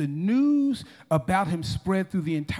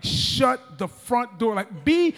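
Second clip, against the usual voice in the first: emphatic silencing; 3 semitones higher, 4 dB louder, slower than usual. Acted. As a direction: punch each word to shut them up. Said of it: "I believe he was like".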